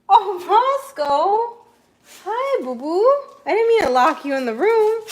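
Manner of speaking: high, loud, stretched coo to the dog; loud doting greeting to a freed dog; excited pet greeting. Real, not acted. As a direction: gush over the dog. Greets dog she locked in.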